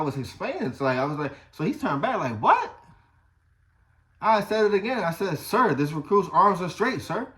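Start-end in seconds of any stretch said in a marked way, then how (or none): 2.4-2.8 high, loud startled what; sharp surprised what. Retold or imitated. Instructor turns back, surprised. 5.4-7.3 loud recruit reply, repeated; barked sir yes sir. Retold or imitated. Repeating to drill instructor.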